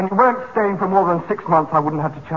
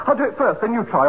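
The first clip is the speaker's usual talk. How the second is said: usual speaking voice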